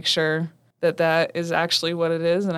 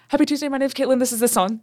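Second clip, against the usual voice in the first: flustered radio greeting; 7 semitones higher than usual. Retold or imitated. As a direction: blurt the intro as the song starts. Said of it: "I go".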